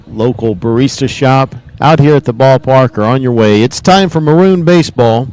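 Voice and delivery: booming radio intro read; big broadcast opener; announcer voice. Acted.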